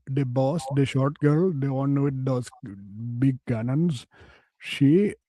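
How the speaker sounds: mock Indian accent, slow in places; man boasting of his fantasy in a mock Indian accent.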